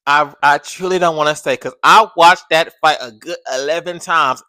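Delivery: ordinary talk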